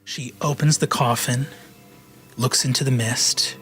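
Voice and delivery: usual speaking voice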